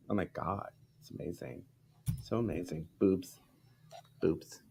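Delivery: lightly voiced Valley girl, breathy and trailing off; a hint of an airy Valley girl cooing over something; mock Valley girl gush. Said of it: "I don't sound like a Valley girl".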